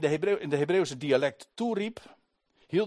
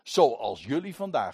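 luid en hoger, gedragen; gedragen toespraak; 4 semitones higher, 6 dB louder than usual. Acted. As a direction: verdedig je vol overtuiging. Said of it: "Hij zegt". (Paulus verdedigt zich luid voor de menigte)

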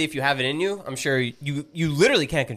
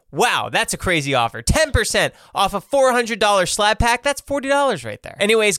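polished, evenly paced sponsor read. Acted. Host pitching sponsors to listeners, in a scripted ad-read voice. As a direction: sell it like a podcast host.

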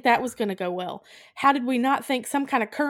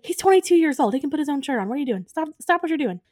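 higher, exasperated imitation of a fed-up friend; mock exasperated Tyler; 6 semitones higher, 3 dB louder, faster than usual. Tyler scolding Eleanor for babying, mock exasperated. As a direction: play Tyler as fed up, lightly voiced. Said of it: "he's like".

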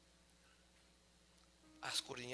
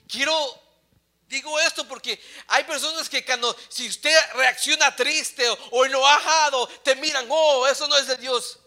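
loud, raised preacher's voice; full-force preaching; 9 semitones higher, 17 dB louder than usual. Real, not acted. Preacher defends those who show sadness to the congregation, full-force preaching. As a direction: push it out high and forceful.

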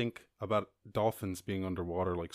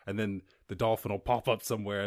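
usual speaking voice

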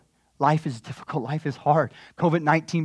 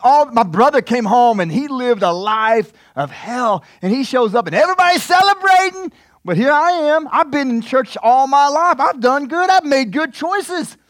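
higher and louder, complaining voice of a churchgoer; resentful church member; 8 semitones higher, 7 dB louder than usual. Retold or imitated. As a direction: play the overlooked good brother, aggrieved. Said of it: "Let's talk to some of the church people".

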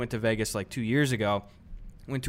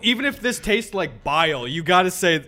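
louder, higher, laughing scolding; mock scolding; 6 semitones higher, 6 dB louder than usual. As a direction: scold a friend while laughing. Retold scolding of Sven, laughing.

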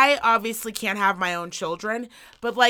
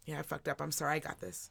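mumbled, much softer admission; sheepish apology; 6 semitones lower, 15 dB quieter than usual. Retold or imitated. Daughter silently owning her mistake, much softer. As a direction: own up quietly, caught out. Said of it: "She was like".

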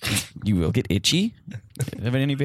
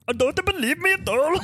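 high, hammed-up mock German accent; full mock German accent; 13 semitones higher than usual. Exchange student showing off his dialect, full mock German accent. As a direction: let a fake German accent loose. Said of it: "If I go full accent, if I let it loose".

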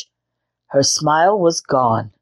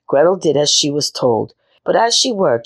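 ordinary delivery, nothing marked

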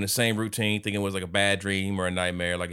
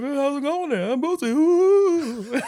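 very high funk-showman voice; playful falsetto ending in a long loud whoop; hammed-up funk-star impression; 6 dB louder than usual. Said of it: "It's my best [name] impression".

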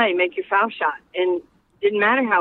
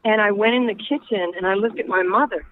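ordinary delivery

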